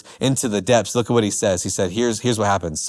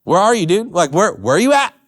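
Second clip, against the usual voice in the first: raised, louder, pleading psalmist; crying out to God; 8 semitones higher, 4 dB louder than usual. Retold or imitated. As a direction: call out to a God who isn't answering. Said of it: "he's crying out to God".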